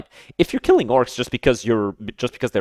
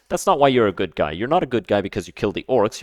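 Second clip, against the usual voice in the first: ordinary talk, nothing marked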